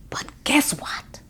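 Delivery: whispered like telling a secret. A narrator's reading. Child shares a secret in prayer.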